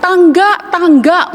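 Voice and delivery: high shout of tangga. Retold or imitated. Man in the well shouting for a ladder, high. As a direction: yell up asking for a ladder. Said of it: "waktu dia teriak-teriak".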